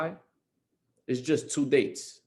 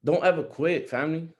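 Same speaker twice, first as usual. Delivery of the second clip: ordinary delivery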